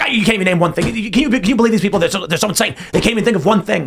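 high, stammering exasperation; mock sputtering. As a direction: imitate a man spluttering in disbelief. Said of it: "kept muttering and sputtering".

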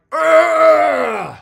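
loud, high yell of revulsion; long shouted groan of mock agony; exaggerated disgusted groan. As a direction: groan out a hammed-up, drawn-out "ugh". Acted disgust at imitated speaker.

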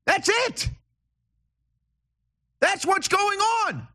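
rant, voice climbing high with exasperation. Real, not acted. Host ranting at caller Miss Lou, voice pitched high. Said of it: "I'm ranting here".

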